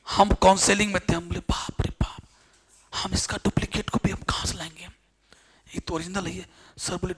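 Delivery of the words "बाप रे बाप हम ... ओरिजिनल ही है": whispering. Retold or imitated. Retelling his own panicked reply.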